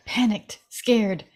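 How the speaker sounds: ordinary talk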